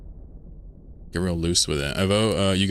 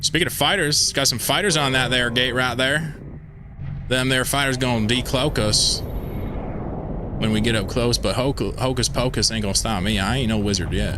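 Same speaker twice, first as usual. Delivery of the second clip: put-on hillbilly accent; 4 semitones higher than usual. Hick voice about gate fighters.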